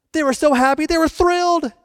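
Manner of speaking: high, excited telling; thrilled. Real, not acted. Preacher tells the congregation the couple's joy, high and excited. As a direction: lift up with delight.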